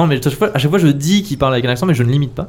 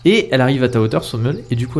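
ordinary talk

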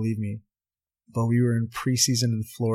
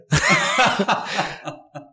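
loud, high laughing fit; burst of laughter; 9 semitones higher, 3 dB louder than usual. Real, not acted. Telling the badge story to the host, laughing out loud.

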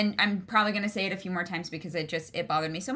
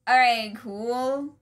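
slow unimpressed; 3 dB louder, much slower than usual. Retold or imitated. Retelling her reaction to Valan Luca.

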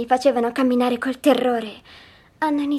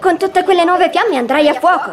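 loud, higher-pitched shouted punchline; retold shout; 5 semitones higher, 9 dB louder than usual. The dreamer retelling Jester's joke to Otto, shouted. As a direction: shout it as the young jester would. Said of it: "Jester grida".

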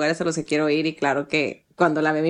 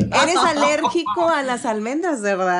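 mock sinister sweetness; 6 semitones higher than usual. Joking about killing husband.

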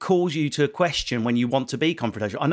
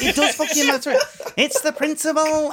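higher, laughing mock rant; mock outraged girlfriend; 11 semitones higher than usual. Girlfriend at a restaurant, mock outrage over wrong order. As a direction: ham up an indignant partner. Voicing girlfriend's outburst, "she's off.

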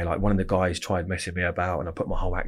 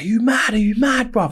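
much higher and louder, mock outraged shout; imitating an angry yell; 4 dB louder than usual. Inmate hit with a pool ball, imitated outraged shout. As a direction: ham up his shocked fury at the attack. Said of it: "And he was like".

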